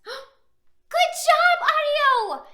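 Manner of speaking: high loud cheer. Acted. Reader praising the hero, cheering. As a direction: cheer him on.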